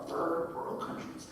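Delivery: slow, hushed half-whisper repeat; quiet puzzled echo. Shown as a demonstration. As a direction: mutter it back to yourself. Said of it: "where did that come from?".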